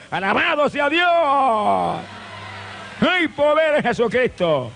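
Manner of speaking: high, slow, crying out praise; preaching shout. Acted. Preacher cries out praise to the congregation, full force.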